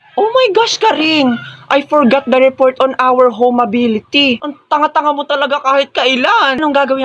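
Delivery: forgot the report, high panicked voice; panicked gasp. Acted. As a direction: shriek in sudden alarm.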